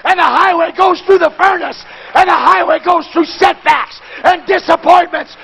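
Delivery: high, loud preacher's cadence; full-force preaching. Acted. Preacher building the congregation up, full-force preaching. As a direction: preach it out to the crowd.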